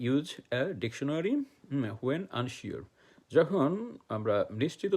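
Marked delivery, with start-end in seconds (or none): 0.0-2.8 very slow, word by word, like dictating to a class; slow dictation. Shown as a demonstration. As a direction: spell each word out slowly for learners. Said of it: "teacher dictating key phrase". (ধীরে ধীরে শিক্ষক শ্রুতিলিখন করাচ্ছেন)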